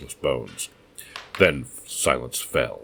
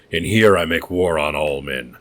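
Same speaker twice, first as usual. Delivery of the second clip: loud, defiant mad host; defiant madman; 5 dB louder than usual. Acted. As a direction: declare war on the world.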